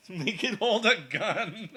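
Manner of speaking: loud, laughing high delivery; cracking up, loud and slow. Real, not acted. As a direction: blurt it through a laugh. Bursts out laughing at idea.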